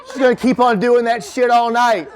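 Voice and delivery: ordinary talk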